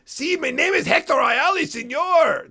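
high hammed-up Spanish accent; a hammed-up Spanish accent pitched high; mock Spanish accent. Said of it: "exactly what he says".

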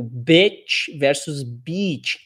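slow "bitch versus beat", short i then long ee. Shown as a demonstration. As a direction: contrast the two vowels slowly. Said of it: "aquela clássica".

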